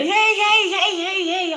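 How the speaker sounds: high mock wail of hey, hey; mock mourners wailing. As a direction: imitate the mourners crying hey over and over.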